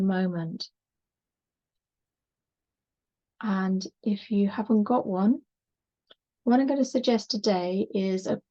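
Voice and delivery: unhurried yoga relaxation voice with long pauses; measured, calming body-scan delivery; guided meditation. Acted. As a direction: gently guide them into rest, leaving space between phrases.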